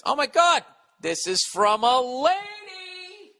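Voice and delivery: put-on high, slow, squealing valley girl gasp; mock girly shock. Comic mocking a female letter writer's shock. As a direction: gush like a shocked teen girl.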